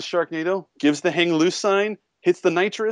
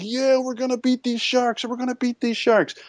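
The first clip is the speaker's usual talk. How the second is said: much higher, hammed-up cheer of triumph; mock cheering crowd; 11 semitones higher than usual. Movie characters cheering over a downed Sharknado, mock triumphant. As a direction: play the overeager crowd sure they've won. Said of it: "Everyone's like".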